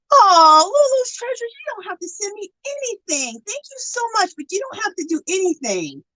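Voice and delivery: high-pitched gush of thanks; touched gushing. Real, not acted. Streamer thanking a viewer for a surprise, touched gushing. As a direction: gush high, softening as it goes on.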